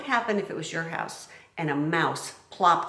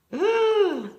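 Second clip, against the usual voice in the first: gasp; 4 dB louder than usual. Real, not acted. Reacting to picture.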